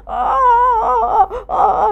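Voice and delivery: high loud fake sobs; ah ah ah, blubbering on purpose; cartoonish crying; hammed-up sobbing. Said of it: "nangis dia".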